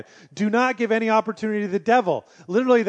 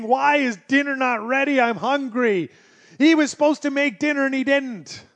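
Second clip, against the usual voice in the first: hungry rant; 7 semitones higher, 4 dB louder than usual. Retold or imitated. Voicing hungry angry person.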